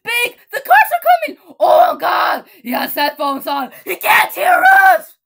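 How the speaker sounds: high, loud panicked screams; frantic high yelling; panicked shouting. Acted. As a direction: scream a warning at someone who can't hear. Panicked warning about cars.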